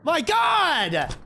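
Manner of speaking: high screaming meltdown, words dragged out; losing it. Real, not acted. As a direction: scream in drawn-out frustration. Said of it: "I'm gonna fucking lose it!".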